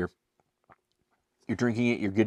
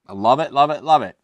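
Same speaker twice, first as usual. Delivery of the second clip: higher, louder sitcom-boss gush; Michael Scott impression; 4 semitones higher, 8 dB louder than usual. Beer reviewer quoting a sitcom boss, higher and louder. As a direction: belt it out like a giddy TV office boss. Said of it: "as Michael Scott would say".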